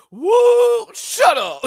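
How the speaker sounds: high, loud mock whoop; mock church whoop; imitating Kenny Davenport. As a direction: shout it like an excited churchgoer. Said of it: "All I heard was Kenny Davenport".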